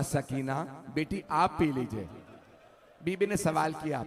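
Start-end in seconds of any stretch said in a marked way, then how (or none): 0.0-2.1 low, hushed aunt's voice; soft, low, grieving tenderness; tender coaxing. Acted. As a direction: gently coax a small child, very softly. Aunt tenderly urges child to drink.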